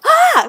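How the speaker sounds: sudden high startled yelp; shrill startled gasp-yell; scared yelp. Retold or imitated. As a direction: yelp in fright at the phone. Sudden scare at phone sound.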